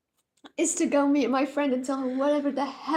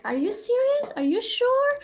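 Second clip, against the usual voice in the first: much higher, rising incredulous questions; mock disbelief; 10 semitones higher, 4 dB louder than usual. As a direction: imitate a professor who won't believe it. Imitating disbelieving professor.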